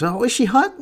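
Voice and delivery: high mocking imitation; mock questioning voice. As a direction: squeak out the question in mock wonder. Mimics confused viewers asking.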